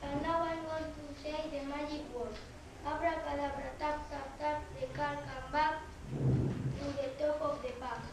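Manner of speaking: put-on showman's patter for children; kids party magician. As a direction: present the card trick to kids. Child magician presents card trick.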